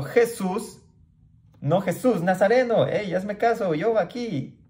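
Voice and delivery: high, urgent call for attention over the crowd; blind man calling out. Acted. Blind beggar shouting to Jesus over the crowd, high calling out. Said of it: "Entonces dio voces diciendo".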